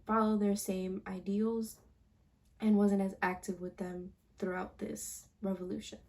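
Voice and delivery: usual speaking voice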